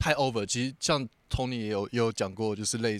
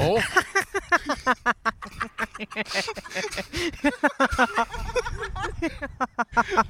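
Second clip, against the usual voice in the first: long high laughter; 12 semitones higher than usual. Real, not acted. Laughs while retelling quarrel.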